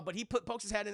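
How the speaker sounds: ordinary delivery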